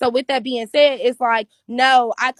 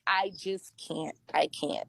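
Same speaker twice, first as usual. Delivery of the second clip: much softer, slow, worn-out refusal; drained refusal; 7 semitones lower, 13 dB quieter, slower than usual. Retold or imitated. She tells him she can't go on, softly and slowly. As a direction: let the words barely come out, exhausted. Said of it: "And I'm like".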